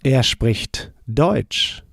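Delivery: slow, clear teacher model of "Er spricht Deutsch"; careful learner-paced German; slow model answer. Shown as a demonstration. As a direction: say it slowly so learners can repeat. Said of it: "How would you say".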